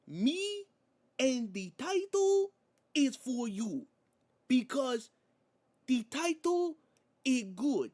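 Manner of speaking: put-on high, loud Spanish-accented wrestler promo, slow and halting; Penta promo impression. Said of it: "my accent is terrible".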